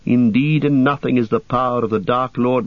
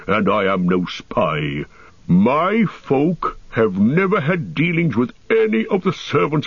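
dwarf Gimli. Acted. Dwarf refusing the elves' blindfold, indignant dwarf voice. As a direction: protest like a proud dwarf with a touch of indignation.

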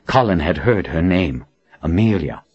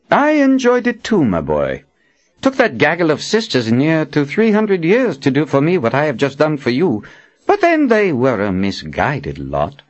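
put-on self-satisfied old prophet; 7 semitones higher than usual. A narrator's reading. Nathan boasts to the young man he just healed, self-satisfied. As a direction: crow over the feat, then ease off.

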